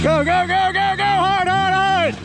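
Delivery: high-pitched yelling at the sweepers; curling skip yelling. Real, not acted. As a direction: shout go and hard at the sweepers. Calling out to sweepers.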